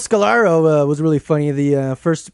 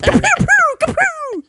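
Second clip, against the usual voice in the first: high laser pew sounds. Acted. Laser pistol noises in prospector voice.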